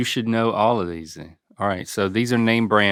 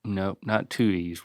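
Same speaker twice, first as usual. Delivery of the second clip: ordinary talk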